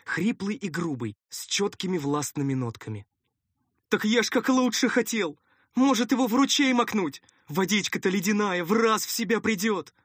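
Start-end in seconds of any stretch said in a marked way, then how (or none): 3.9-9.9 high, loud defensive protest; high-pitched, hurried-to-please helper; flustered underling. Acted. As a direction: justify yourself eagerly in a high voice. First helper defends himself.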